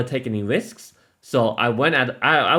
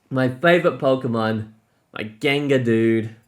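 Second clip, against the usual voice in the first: normal talking voice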